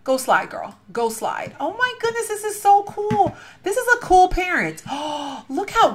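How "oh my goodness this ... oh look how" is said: high, slow delighted squeal; gushing excitement. Real, not acted. Touring a viewer's home, squealing with delight. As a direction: swoon over how cool it is, pitched way up. Gushing over a house tour.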